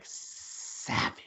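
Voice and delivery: SAVAGE drawn out in a hushed rasp; emphatic SAVAGE. Shown as a demonstration. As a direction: stretch out SAVAGE, half-whispered for effect. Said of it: "you need that, uh, emphasis".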